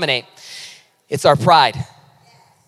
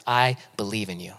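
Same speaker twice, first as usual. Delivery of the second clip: soft inner voice; 10 semitones lower, 9 dB quieter than usual. Retold or imitated. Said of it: "all I kept hearing was".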